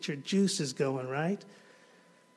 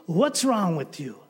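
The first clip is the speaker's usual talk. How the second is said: put-on indignant boy David; 7 semitones higher, 5 dB louder than usual. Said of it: "And he looks at them and says".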